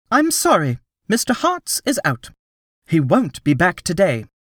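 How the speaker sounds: put-on receptionist girl voice. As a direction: chirp it like a desk receptionist.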